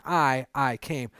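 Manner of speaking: "I, I came." with I repeated and stressed, slowly; slow, weighted "I, I came."; emphatic I. Shown as a demonstration. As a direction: lean hard on "I". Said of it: "they're being very emphatic".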